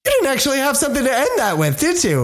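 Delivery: teasing. Real, not acted. Teasing co-host.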